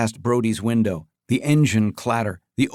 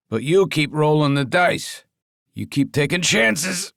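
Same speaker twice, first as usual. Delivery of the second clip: grunting; 5 semitones higher than usual. Acted. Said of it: "Jimmy grunted".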